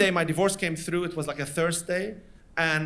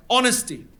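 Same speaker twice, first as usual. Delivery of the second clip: one word, firmly projected; all-caps emphasis; 3 semitones higher, 4 dB louder than usual. Acted. Older dater writing what she wants in a man, emphatic. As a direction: say it as if typed in all capitals. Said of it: "one word, all caps".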